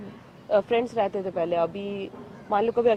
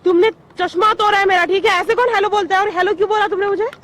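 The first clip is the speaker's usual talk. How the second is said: sustained loud, high-pitched tirade; angry scolding; 8 semitones higher, 6 dB louder than usual. Real, not acted. Woman berates man for hello and broken glasses, angry. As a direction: pile up the accusations, indignant.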